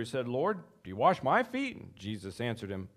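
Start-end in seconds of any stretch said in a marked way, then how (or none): 0.3-1.8 raised, questioning Peter; a startled disciple asking his Lord; voicing Peter. Preacher voicing Peter's startled question.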